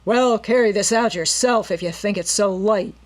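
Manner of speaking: loud rough resentful retort; angry henchman. A narrator's reading. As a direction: throw it back in anger. Henchman snapping back at leader.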